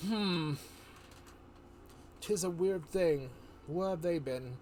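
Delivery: lightly voiced human adventurer; human friend. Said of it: "A human friend, he's like".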